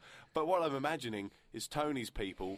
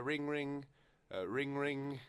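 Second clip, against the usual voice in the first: lightly voiced ringing phone, higher pitch; imitated phone ring; 3 semitones higher, slower than usual. Host fakes an incoming call to co-host, imitated ringing phone. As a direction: mimic a phone ringing, half-sung. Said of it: "the phone's ringing".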